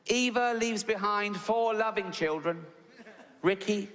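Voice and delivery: slow, measured funeral reading, starting high and stepping down; confident vicar. Retold or imitated. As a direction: read the names out like a vicar at the pulpit, pausing between each. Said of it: "all confident with his little notes".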